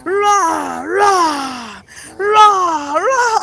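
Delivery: hammed-up tiger rah for a toddler; mock roaring tiger game; playful tiger roar. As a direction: roar like a friendly pretend tiger. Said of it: "Can you be a tiger?".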